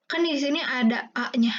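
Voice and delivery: ordinary talk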